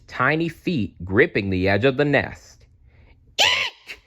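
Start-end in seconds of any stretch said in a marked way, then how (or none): 3.4-4.0 very high squealing shriek; shrieked eek. A narrator's reading. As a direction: shriek in shock at the hanging babies. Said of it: "she cried".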